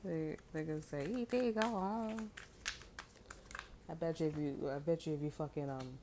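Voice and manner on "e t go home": high, drawn-out E T voice; slow alien voice. Retold or imitated. Mimicking scared people in a slow, high E T voice. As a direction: stretch it out like the alien.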